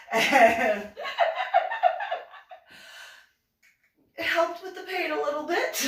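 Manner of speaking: high, loud, bursting with laughter; laughing. Real, not acted. Explaining rave helped the pain, laughing hard. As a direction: push the words out through a laughing fit.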